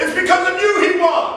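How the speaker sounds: high, excited retold exclamation; elated father. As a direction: play a thrilled dad crediting the coach. Retold elated father crediting the coach.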